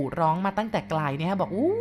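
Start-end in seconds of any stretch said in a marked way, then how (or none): 1.5-1.7 high, drawn-out "ooo" called from far away; a searcher's long hooting call in the night; distant calling hoot. Retold or imitated. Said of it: "ได้ยินเสียงคนกู่ร้องมาตั้งแต่ไกล".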